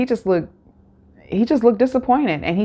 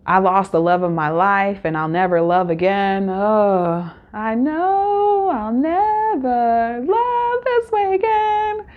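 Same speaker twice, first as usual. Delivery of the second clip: mock heartbroken melodrama; slower than usual. Mocking the crying contestant in a hammed-up lovesick wail. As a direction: overplay her tragic heartbreak, stretching the last words. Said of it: "That was bad".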